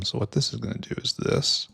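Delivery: fast breathy whisper under breath. Real, not acted. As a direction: mutter it to yourself quickly. Muttering while placing belts.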